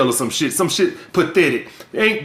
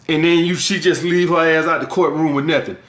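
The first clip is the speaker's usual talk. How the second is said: ordinary talk, nothing marked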